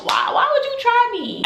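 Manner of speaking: high, drawn-out exasperated wail; exasperated demand. Acted. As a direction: stretch it out way up high, in disbelief. Demanding why her friend betrayed her.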